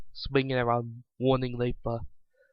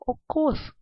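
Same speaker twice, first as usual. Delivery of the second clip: much higher, bright motherly voice; happy mother; 7 semitones higher than usual. A narrator's reading. Vanilla reassuring Rainbow Dash about the outfit, happy motherly. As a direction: reassure cheerfully like a doting mom. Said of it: "Vanilla said happily".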